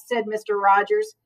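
usual speaking voice

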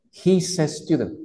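"He's a student" with the S run into a quick E-like "a"; linked He's a. Shown as a demonstration. Teacher modelling linked speech for the class. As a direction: glue the S of "He's" to a fast "a". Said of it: "escucha que yo pego la S con la A".

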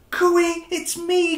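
high, loud little-fish call. Acted. Stanley calling out to his friends, high cheerful call. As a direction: sing out to find your friends. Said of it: "he called".

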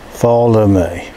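ordinary talk

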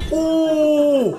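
pained yelp. Real, not acted. Hit in the fight.

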